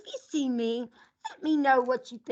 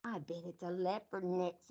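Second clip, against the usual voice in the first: ordinary delivery, nothing marked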